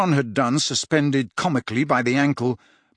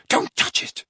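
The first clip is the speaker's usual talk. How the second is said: urgent whisper. Acted. Harry warning Ron.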